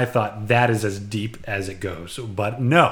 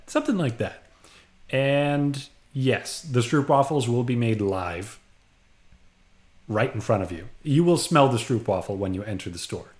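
ordinary talk

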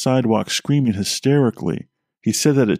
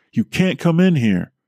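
retold scream; 6 semitones higher than usual. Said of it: "I remember screaming".

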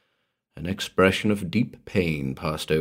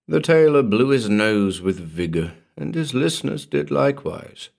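plain reading voice

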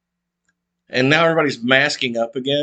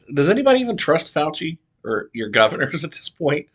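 usual speaking voice

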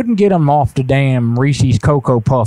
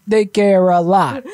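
sarcastic; 7 semitones higher, slower than usual. Real, not acted. Mocking echo of sarcastic remark.

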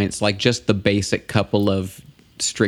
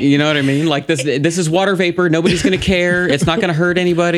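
excited rant; 8 semitones higher, 5 dB louder, faster than usual. Real, not acted. Ranting about vaping ban.

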